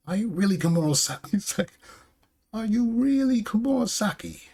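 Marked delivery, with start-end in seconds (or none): 0.1-1.3 high, disbelieving acted question; incredulous question. Orochi doubts who the masked player is, incredulous. 2.5-4.4 very high, held-out incredulous question; hammed-up squeal of disbelief; exaggerated disbelief. Orochi asks if she is really Komurosaki, shrieking disbelief. Retake of Orochi's challenge, higher.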